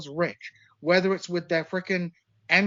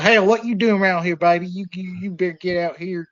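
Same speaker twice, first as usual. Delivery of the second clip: louder, hammed-up bad Southern cop accent; 5 dB louder than usual. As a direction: play a Southern cop hassling kids, badly. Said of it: "Still have a horrible Southern accent".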